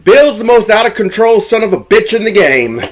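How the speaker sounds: high, hammed-up proclamation; hyped exclamation. Retelling a teammate bragging on Bill at dinner, hyped. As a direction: hype up a friend like a wild legend.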